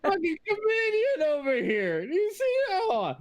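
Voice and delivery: very high, slow laugh-choked voice; high wheezing laugh of a dwarf. Acted. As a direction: squeal through laughter at the joke.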